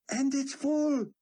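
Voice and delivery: high, slow, loud wonder; amazed delight. A narrator's reading. Yaakov marveling the bottle is full, high and slow.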